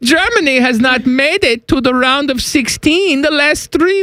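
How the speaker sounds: put-on high-pitched comic German accent. Wolfgang complaining about Germany's World Cup failures, in a German accent. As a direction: rant as a high-voiced German pundit. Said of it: "This is my German accent".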